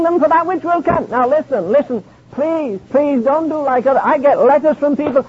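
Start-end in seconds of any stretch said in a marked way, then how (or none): none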